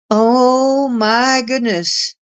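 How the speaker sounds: slow, drawn-out astonishment; retold amazement. Retold amazement at surprise visitor.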